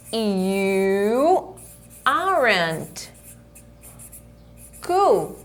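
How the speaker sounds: slow, with long pauses between words; slow model sentence. Shown as a demonstration. Teacher modelling the contracted form slowly. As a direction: drop each word in separately. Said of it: "Ou eu posso falar".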